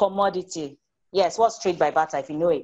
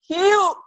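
mock disgusted phew; 12 semitones higher, 5 dB louder than usual. Reacting to messy room.

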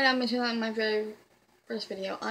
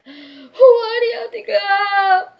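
high, loud wailing cry; 11 semitones higher, 13 dB louder, slower than usual. Retold or imitated. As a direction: wail it like bawling. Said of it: "I wanna bawl my fucking eyes out because I'm like".

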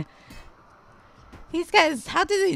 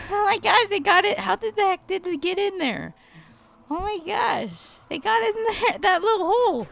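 high, amazed squeals; high-pitched excited shock; excited disbelief; 4 dB louder than usual. Real, not acted. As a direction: shriek with disbelief that they got in. Units break in, shocked.

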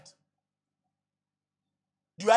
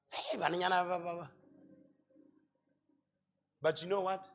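ordinary delivery

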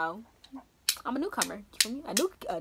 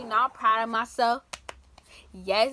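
ordinary talk

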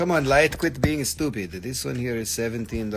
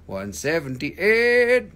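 slow, stretched, a bit louder; drawn-out announcement; 5 semitones higher, 6 dB louder, much slower than usual. Shown as a demonstration. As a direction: stretch the number out like an auctioneer. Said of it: "repeats the number".

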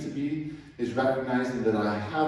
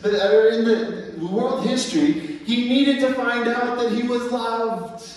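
high, loud sermon climax, slowing at the end; impassioned preaching; 11 semitones higher, 4 dB louder than usual. Acted. Preacher to congregation, impassioned and loud. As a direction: preach it at full force.

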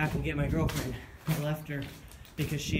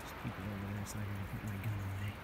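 hushed; 6 semitones lower, 13 dB quieter, faster than usual. Real, not acted. People nearby, putting gun away.

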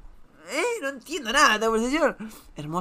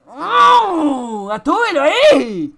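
louder, slower cry of delight; excited exclamation; 3 semitones higher, 7 dB louder, much slower than usual. Real, not acted. Reacting to a cat-man meme photo, louder excited cry. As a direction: hail the picture with glee.

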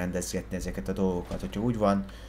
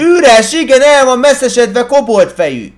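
furious, much higher and louder yell; angry shouting; 16 dB louder than usual. Acted. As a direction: scream it out in a rage. Insulting him while yelling.

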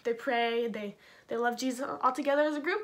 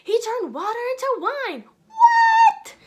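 very high, loud disbelief; astonished crowd; 12 semitones higher, 12 dB louder, slower than usual. Acted. Crowd hearing the miracles, shrieking high disbelief. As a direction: shriek with amazement. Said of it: "they're all like".

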